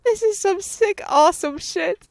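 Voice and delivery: high, thrilled aside; excited. Real, not acted. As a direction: gush with delight. Player reacts delighted.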